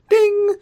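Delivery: high ringing "ding"; imitated ding. Pointing out a cliche, imitated bell ding. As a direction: sing out a bell chime on one high note.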